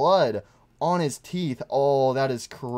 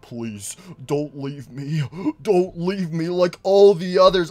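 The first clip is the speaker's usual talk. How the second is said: building plea; pleading Golden Freddy; 4 dB louder, slower than usual. Retold or imitated. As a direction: plead not to be left. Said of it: "He's like".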